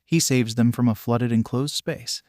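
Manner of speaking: ordinary delivery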